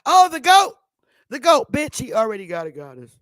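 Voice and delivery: loud, high indignant scolding; mock outrage at the vultures; scolding girlfriend. South Wind scolding the women.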